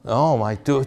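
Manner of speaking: usual speaking voice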